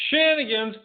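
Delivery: shenanigans drawn out high and loud; mock drawn out. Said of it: "don't say shenanigans".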